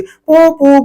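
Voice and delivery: high, bouncy honk of a rickshaw bulb horn; horn honk imitation. Shown as a demonstration. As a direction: squeeze out a playful horn sound. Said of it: "वो जो बजा लेते हैं".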